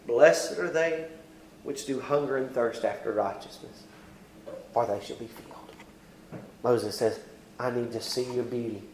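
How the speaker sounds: quiet intimate close of a sermon; hushed preaching. Acted. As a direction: draw them in with a hush.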